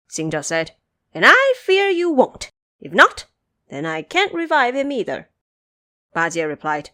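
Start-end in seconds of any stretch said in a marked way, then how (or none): 1.1-5.3 higher, louder needling; teasing monkey. Acted. Xing Zhe goading Ba Jie, higher and louder. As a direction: goad the pig.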